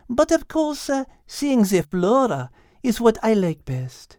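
put-on high, French-accented gentle guide voice; a cheerful French cow warning her friends; high French-accented voice, caution about avalanches; French cow Liz. Liz describes mountain sights.

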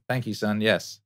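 normal talking voice

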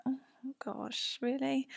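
breathless, hushed gasp of surprise; shocked whisper. Retold or imitated. Reacting to the healer asking about her throat, whispered. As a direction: whisper it as if stunned.

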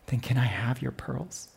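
quiet, gentle ask; soft father question. Retold or imitated. Father at bedtime asking his daughter softly. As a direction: ask it tenderly, barely above a murmur.

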